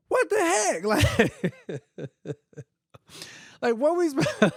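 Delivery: very high, squeaky mock outcry breaking into laughter; laughing disbelief. Couple hearing the fire will reach their house, laughing disbelief. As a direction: crack up while crying out in disbelief.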